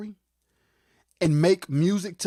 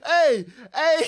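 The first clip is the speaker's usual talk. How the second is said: loud, high hype shout; hey hey hollered loud and high; excited shout; 6 semitones higher, 4 dB louder than usual. Real, not acted. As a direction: call it out like a hype man. Hyped reaction to quote.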